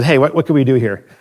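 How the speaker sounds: normal talking voice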